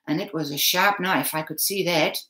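usual speaking voice

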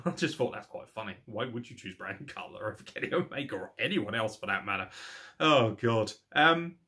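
ordinary talk

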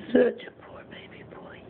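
softly whispered pity. Acted. Owner to old cat Buddy, pitying whisper. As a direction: breathe it out in a hushed whisper. Same phrase repeated, whispered.

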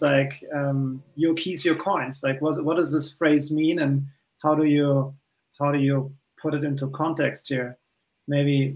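normal talking voice

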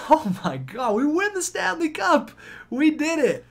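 cheering the win anew; joyful again. Real, not acted. Realizes they still won.

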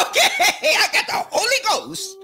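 high-pitched, laughing mock testimony; laughing mock churchgoer. Preacher mimicking indignant foot-stomping believers, laughing. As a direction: playfully mimic an excited believer through laughter.

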